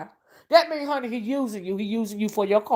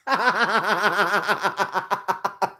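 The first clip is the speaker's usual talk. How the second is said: low, rapid-fire cackle; long rattling laugh; 6 semitones lower, 6 dB quieter than usual. Real, not acted. Amused at her own mocking song, long low cackle. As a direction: crack up and let it run on. Cracks up after own singing.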